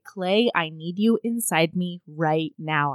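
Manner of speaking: low, soft, slow pleading; lightly voiced heroine wanting him now; retold lover's plea. Heroine begs Clay in bed, low slow plea.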